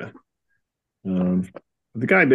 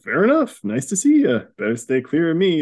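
higher-pitched, mock friendly greeting; all smiles; 6 semitones higher than usual. Gray guy now accepts the hero, playfully all smiles. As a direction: greet him with an audible smile. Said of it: "the guy's all smiles now then".

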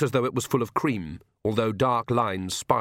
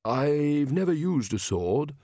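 slow, higher, tentative voice; faltering, mild-mannered ruler; weakened, hesitant king; 5 semitones higher, slower than usual. A narrator's reading. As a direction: admit it slowly and unsurely. Weakened king admits inexperience.

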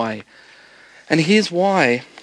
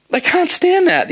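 sudden high emphasis; 13 semitones higher, slower than usual. Real, not acted. Preacher stressing rejection.

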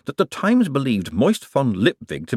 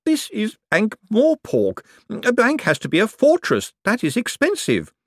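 prim banker voice; 13 semitones higher than usual. A narrator's reading. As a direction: lecture like a stiff clerk. Bent lectures Moist on banking.

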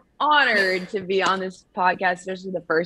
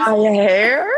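surprised; 3 semitones higher, 7 dB louder than usual. Real, not acted. Shocked at losing hair.

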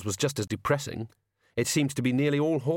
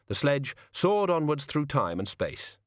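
usual speaking voice

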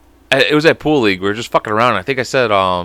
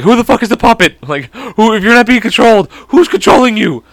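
high, raised-voice mock panic; alarmed; 9 semitones higher, 4 dB louder than usual. Reacting to a laughing puppet at night, mock alarm. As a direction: demand answers in comic alarm.

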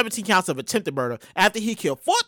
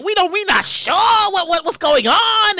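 scared police; 8 semitones higher, 4 dB louder, slower than usual. Retold or imitated. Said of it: "they was like".

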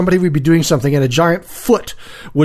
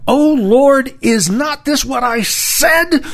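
high, tight angry complaint; through clenched teeth; 9 semitones higher, slower than usual. Retold or imitated. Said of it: "through clenched teeth".

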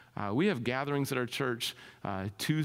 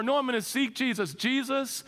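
high-pitched, insistent cry to Jesus; 10 semitones higher, 4 dB louder than usual. Acted. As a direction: cry out as Bartimaeus refusing to be hushed. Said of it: "Bartimaeus cries out all the more".

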